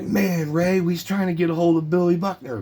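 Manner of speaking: high-pitched mock holler at the player; calling out.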